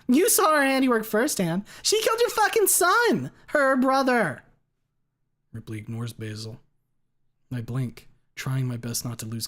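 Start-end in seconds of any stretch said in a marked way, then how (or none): 0.1-4.4 high, loud, unhinged yell; raging scream. A narrator's reading. Basil screaming about his dead son. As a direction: hurl the accusation. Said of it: "He starts thrashing again".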